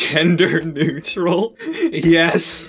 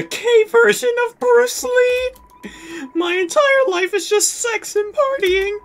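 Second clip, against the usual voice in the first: high character voice; 14 semitones higher than usual. Acted.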